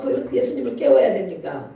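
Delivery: normal talking voice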